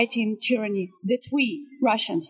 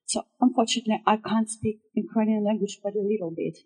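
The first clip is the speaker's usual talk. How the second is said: ordinary talk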